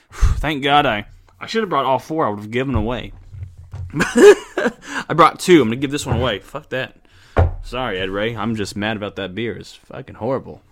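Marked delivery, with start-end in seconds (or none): 3.5-5.6 slow, laughing confession; laughing through the words, slow. Real, not acted. Laughing at own mistake. 6.4-10.6 quiet. Real, not acted. Muttered apology after swearing.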